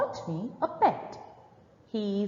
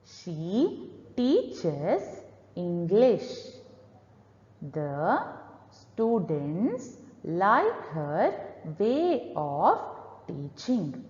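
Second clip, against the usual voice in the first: slow, dragged-out reading with long gaps; painfully slow reading aloud; too slow; 3 semitones lower, much slower than usual. Shown as a demonstration. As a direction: stretch every word far too slowly. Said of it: "this is the slow pace you need to avoid".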